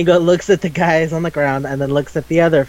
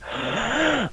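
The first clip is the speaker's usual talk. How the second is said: sharp mock gasp of horror; acted-out gasp, aghast; horrified gasp; 6 semitones higher, 8 dB quieter than usual. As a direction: suck in a long, shocked breath. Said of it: "that horrified moment where".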